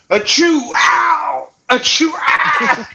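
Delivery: high yelped fake sneezes and ows; fake sneezing fit. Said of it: "I was like".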